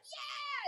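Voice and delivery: high, soft mock cheer; imitated crowd cheer. Imitating everybody cheering her answer, soft high cheer. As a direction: cheer like a crowd, kept small. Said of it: "everybody's like".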